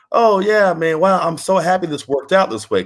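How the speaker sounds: high, glowing retold relief; happy relief. Wrestler realizing his good fortune years later, delighted. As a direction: act out a delighted realization, pitched well up.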